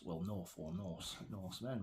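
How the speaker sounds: ordinary talk, nothing marked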